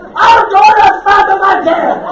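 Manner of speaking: high, frantic shout; panicked yell. Retold or imitated. Paddler yelling back at the honking ship, panicked shout. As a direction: holler back in loud, high-pitched panic. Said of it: "And I panic".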